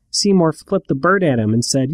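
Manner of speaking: ordinary talk, nothing marked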